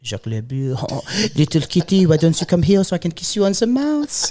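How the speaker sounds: French cartoon lover's accent, rising in pitch; Pepe Le Pew French accent. Man showing his friend a French accent, hammed-up French seducer. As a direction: woo in a hammy French accent. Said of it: "Can you do a French accent".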